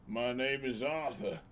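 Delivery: slow, drawn-out American cowboy introduction; American accent. Pretend. Streamer playing Arthur for chat, slow American accent. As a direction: introduce yourself in a put-on American drawl. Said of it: "Can you do an American accent?".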